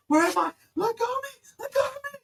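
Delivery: very high, loud terrified scream; high frantic crying scream; panicked screaming. Acted. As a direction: shriek like she's waking in a fire. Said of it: "and she's like".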